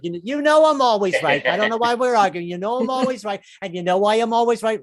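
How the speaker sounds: high, loud know-it-all wife; smug wife. Retold or imitated. Wife winning the argument, high and loud. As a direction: lay down the law, smug. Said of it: "she pulls out the sex cards".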